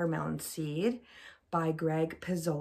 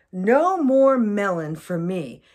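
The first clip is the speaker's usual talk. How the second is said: louder, resolute crocodile vow; firm vow; 4 semitones higher, 12 dB louder than usual. Acted. Crocodile vowing loudly to give up melon. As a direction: declare it with mock determination.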